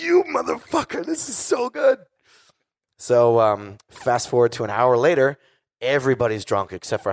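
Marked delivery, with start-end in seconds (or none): 0.0-2.0 high-pitched gushing squeal; retold amazed delight. Singer reacting to friend's new song, high excited mock squeal.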